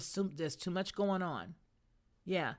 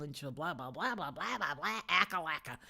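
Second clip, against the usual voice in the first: imitated flattery dissolving into blah-blah nonsense; mock garbage babble. Imitating an admirer's flattery dribbling out, mocking babble. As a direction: babble it dismissively, syllables running together. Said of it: "Just a bunch of garbage came r-, dribbling out".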